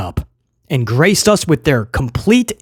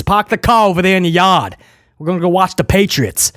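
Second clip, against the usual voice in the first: hammed-up Boston accent, dropping the R's; faster than usual. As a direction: slip into a joking Bostonian accent. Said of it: "I don't know where that accent came from".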